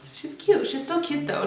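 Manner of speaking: high playful cooing; cute high voice. Real, not acted. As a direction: coo over the look. Admiring her own makeup look.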